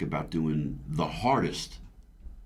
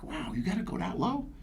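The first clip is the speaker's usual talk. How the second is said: mock disbelief; 4 dB quieter than usual. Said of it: "I was like".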